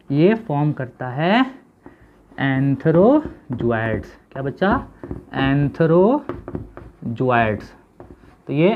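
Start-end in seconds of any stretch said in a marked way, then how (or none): 2.4-4.1 antherozoids drawn out and split; slow term drill. Shown as a demonstration. Teacher introducing a new term to pupils, very slowly. 5.4-7.6 antherozoids drawn out and split; slow term drill. Shown as a demonstration. Teacher introducing a new term to pupils, very slowly.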